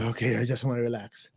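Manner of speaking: soft, sleepy mock voice; mock relaxed sigh. Mimicking someone who just wants to relax, softly. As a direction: breathe it out like someone about to doze.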